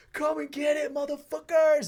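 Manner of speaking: re-enacted war cry; battle taunt yell. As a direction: yell a dare at the enemy mid-fight. Acts out his taunt in battle.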